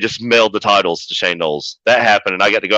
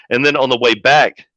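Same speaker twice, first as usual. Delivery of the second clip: ordinary delivery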